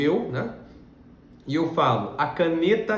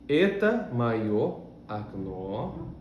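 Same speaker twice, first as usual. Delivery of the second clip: slow, careful teacher reading; very slow model reading; 3 dB quieter, much slower than usual. Shown as a demonstration. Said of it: "Esta é a minha janela".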